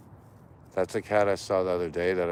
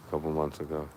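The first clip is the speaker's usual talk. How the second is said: ordinary talk, nothing marked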